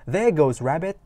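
lively mock exclamation; excited exclamation. Shown as a demonstration. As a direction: call it out as it darts past. Shows sudden sighting exclamation.

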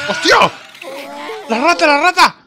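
real panicked outburst; startled shout. Real, not acted. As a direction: cry out at a sudden scare. Rat suddenly appears.

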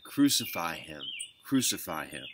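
contained, falling exclamation; restrained crying out. A narrator's reading. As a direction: read the crowd's demand firmly without shouting. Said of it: "they cried out again".

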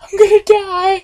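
hammed-up frightened squeal; mock panic. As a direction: squeak it like a terrified kid. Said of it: "I'm like".

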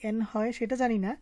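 ordinary talk

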